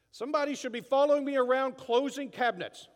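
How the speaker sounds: loud, high entitled complaint; hammed-up outraged grumble; indignant rant. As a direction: demand it like someone failed you. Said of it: "Instead, we go like".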